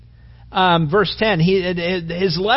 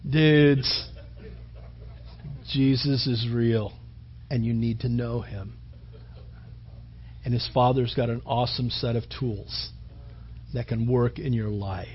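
lazy California stoner preaching; laid-back surfer dude, much lower and softer; Spicoli surfer voice; 9 semitones lower, 9 dB quieter than usual. Pretend. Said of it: "maybe he had a Spicoli voice".

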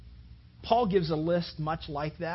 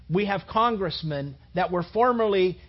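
louder, slower, building sermon thunder; forceful preaching; 3 semitones higher, 8 dB louder than usual. Real, not acted. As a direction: drive it home from the pulpit. Preaching rises to full force.

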